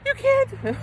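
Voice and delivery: very high, drawn-out kiddie whine; mock outraged child voice; protesting kids. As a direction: imitate children squealing in protest.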